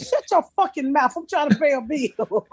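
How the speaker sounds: put-on retelling snapping at the kid while on the phone; mock scolding. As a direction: snap it like shushing a kid on the phone. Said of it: "I said".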